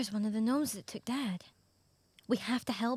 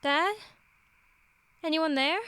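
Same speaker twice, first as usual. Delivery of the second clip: raised, questioning call; searching call; 4 semitones higher, 5 dB louder than usual. Acted. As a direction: call out into the dark for someone. Calling for father alone at night.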